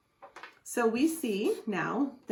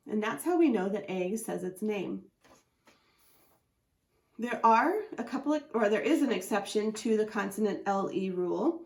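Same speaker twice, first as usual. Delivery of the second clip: normal talking voice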